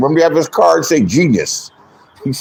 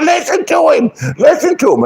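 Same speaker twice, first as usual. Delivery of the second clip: high, shouted mockery of Rex; mocking high shout; 10 semitones higher than usual. Rex yelling on the panel, high mocking shout. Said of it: "He said".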